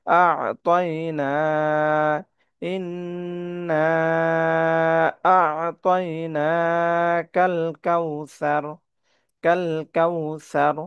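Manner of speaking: long madd in আ'তাইনা; three-alif stretch. Shown as a demonstration. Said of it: "তিন আলিফ টেনে পড়বো".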